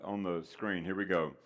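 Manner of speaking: ordinary talk, nothing marked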